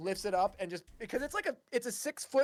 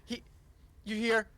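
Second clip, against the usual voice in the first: ordinary delivery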